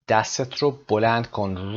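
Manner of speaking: ordinary delivery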